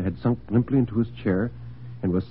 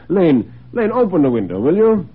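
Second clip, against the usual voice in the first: raised, higher voice summoning a servant; calling the butler; 7 semitones higher, 6 dB louder than usual. Acted. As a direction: call out a brisk household order. Host calls out to the butler.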